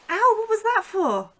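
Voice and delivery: sudden high yelp of pain and surprise; startled ow. Acted. [name] yelps after the unexpected flick, high startled ow. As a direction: caught off guard, yelp it high and loud. Gets flicked mid-lesson.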